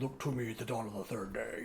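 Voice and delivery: low, quiet hint of a wizard's solemn voice; Gandalf. Quoting the wizard before he rides off, a low hushed hint of a solemn wizard. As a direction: lightly voice an old wizard's promise, low. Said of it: "Gandalf had gone off and said".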